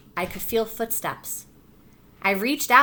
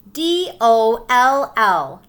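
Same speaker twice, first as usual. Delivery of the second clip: slow spelling; 8 dB louder, much slower than usual. Shown as a demonstration. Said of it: "Can you feel the letters?".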